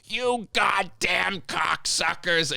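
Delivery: high, slow mock yell of a furious trucker; mock angry trucker. Worker imitating a furious trucker bursting in. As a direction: ham up the trucker's outburst, pitched high.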